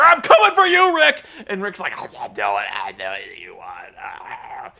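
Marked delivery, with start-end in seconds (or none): 0.0-1.2 high, loud wrestler's war cry; mock threatening shout. 2.0-4.7 low, choked croak, trailing off; hammed-up strangled gurgle; imitated strangulation. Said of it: "strangulation sounds".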